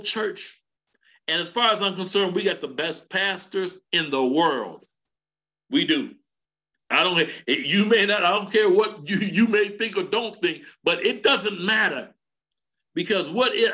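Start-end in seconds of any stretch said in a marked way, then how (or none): none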